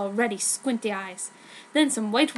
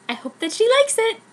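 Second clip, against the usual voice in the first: gushing hope; 10 semitones higher, 5 dB louder than usual. Acted. Fanfic author's gushing note.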